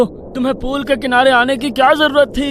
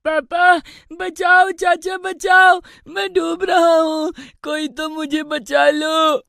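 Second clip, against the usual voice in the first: high desperate shouts for help; drowning child screaming; 4 semitones higher, slower than usual. Acted. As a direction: yell for rescue.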